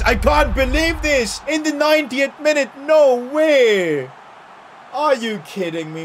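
high, loud cry of dismay; anguished disbelief. Real, not acted. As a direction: wail at a last-minute goal. Concedes in ninetieth minute.

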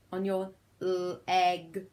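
monotone robot, LEG split into l and egg. Shown as a demonstration. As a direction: slip into robot talk, word by word. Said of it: "in my robot voice".

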